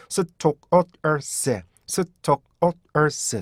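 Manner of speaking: stores split into separate beats, 스톡-어-스; 스톡어스, each sound cut off on its own; syllable by syllable. Shown as a demonstration. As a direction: break 스톡어스 into slow, detached syllables. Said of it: "세 번째 음절에서".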